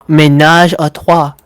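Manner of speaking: loud, showy French accent; French pronunciation. Shown as a demonstration. Friend showing how the French say it, loud French accent. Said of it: "That's how they say it".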